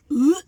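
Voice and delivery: loud grossed-out "Ugh"; disgusted ugh. Real, not acted. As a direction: recoil from the smell. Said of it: "disgust at poo".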